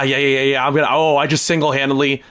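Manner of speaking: ordinary talk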